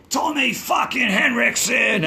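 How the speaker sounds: loud, high New York holler; mock New York accent. Guitarist backstage mimicking his New York accent, shouting. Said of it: "he does my New York accent".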